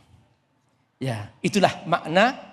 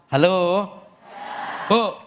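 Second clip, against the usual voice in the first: calling out; slower than usual. Real, not acted. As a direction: call out to wake the audience. Calling out to audience. (memanggil keras ke jamaah)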